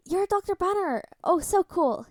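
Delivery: high, loud starstruck gush. Acted. Drugged boy gushes at Bruce Banner, high and loud. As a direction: burst out like a fanboy. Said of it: "he gushed".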